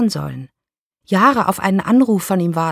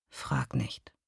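softer, lower remorseful whisper; contrite whisper; 5 semitones lower, 14 dB quieter than usual. A narrator's reading. Dying ex-lover to his former lover, contrite whisper. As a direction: breathe it out, ashamed. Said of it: "sagte er zerknirscht".

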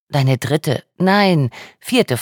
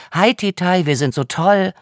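higher, hammed-up voice of a smug rich student; mocking a posh party crowd in a higher voice; mock snob; 6 semitones higher than usual. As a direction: parody a braying yacht-club boy.

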